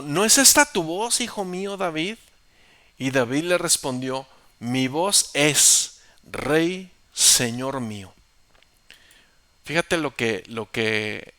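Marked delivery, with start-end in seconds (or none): 0.0-2.2 high, loud call; astonished call. Acted. Saul calling out to David, recognizing his voice, high. 4.6-8.1 slow, loud respectful reply; reverent answer. Acted. David answering King Saul with reverence, slow.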